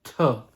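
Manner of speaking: "tuh" with a sharp, loud T burst; sharp T sound. Shown as a demonstration. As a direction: punch the T in "tuh". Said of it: "the sharp T sound".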